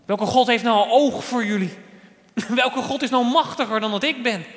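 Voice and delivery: loud, high boastful challenge; calling out. Acted. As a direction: act out a king hurling a taunt. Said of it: "riep Nebukadnezar".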